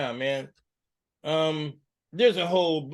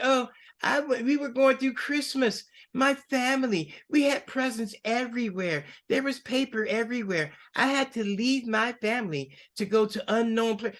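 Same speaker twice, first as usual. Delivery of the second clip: higher, lightly mocking governor voice; mocking her voice; 7 semitones higher than usual. Host mimicking the governor's Christmas story, mocking. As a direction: parody her sob story. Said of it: "Then she said".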